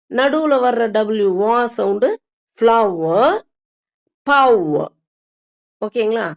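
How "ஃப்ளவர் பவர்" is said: ஃப்ளவர், பவர் with a firm, held W in the middle; pressed middle W. Shown as a demonstration. Teacher drilling middle W for learners, pressed firmly. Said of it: "அழுத்தி சொல்லிக்கணும்".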